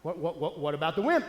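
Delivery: ordinary delivery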